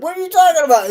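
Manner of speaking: high, loud, baffled question; confused reaction. Pretend. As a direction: act out a baffled listener. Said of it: "They'll be like".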